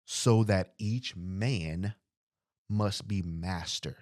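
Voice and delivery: very slow, word by word; slow reading. Shown as a demonstration. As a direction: read it out slowly so every word lands. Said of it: "What does your Bible say?".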